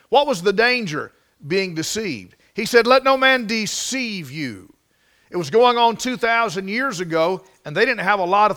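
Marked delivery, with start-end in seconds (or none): 2.9-4.7 slow, raised, "deceive" stretched out; measured and emphatic, drawing out "deceive"; stress on deceive. Shown as a demonstration. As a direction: spell out the warning slowly, leaning on "deceive". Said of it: "I wanna put some emphasis on that word".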